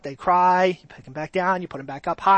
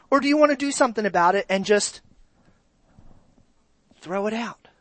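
normal talking voice

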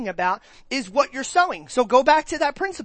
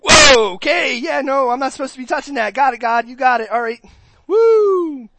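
jolted back into line; busted kid rushing to obey; startled and caught out; 9 dB louder than usual. Retold or imitated. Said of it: "You're like".

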